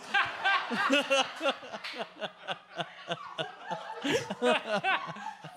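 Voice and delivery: high, long laughing fit. Real, not acted. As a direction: burst into sustained laughter. Laughs at own joke.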